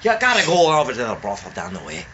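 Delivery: a crude hammed-up Irish brogue; bawdy pub voice, mock Irish; mock accent. Friend doing a crude Irish drunk, mock Irish accent. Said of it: "your accents are fucking nuts".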